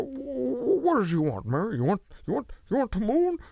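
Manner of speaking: put-on high, stammering old-film leading man; old-movie impression. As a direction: stammer it like a classic film hero. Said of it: "I already got".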